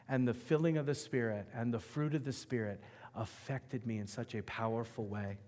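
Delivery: ordinary delivery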